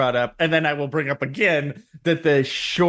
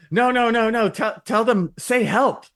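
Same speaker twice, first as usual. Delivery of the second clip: raised, pleading voice; high mock pleading; 12 semitones higher than usual. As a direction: squeak out the plea. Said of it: "they're like".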